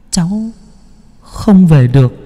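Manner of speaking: put-on high, small child's voice from far off; a ghostly boy murmuring; boy's faint voice. A narrator's reading. The drowned boy Thủy calls to uncle Mộc, faint high child's voice. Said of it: "giọng nói của thằng Thủy lý nhí".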